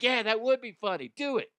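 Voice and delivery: high, eager little angel. Retold or imitated. Good angel in his head agreeing, high and eager. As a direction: cheer it on like a naughty angel. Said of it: "the good angel's like".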